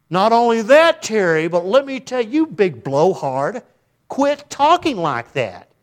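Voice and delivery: hammed-up scolding, slowing on big blowhard; mock rude put-down. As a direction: talk down to Terry like a blowhard. Mock rude reply to Terry.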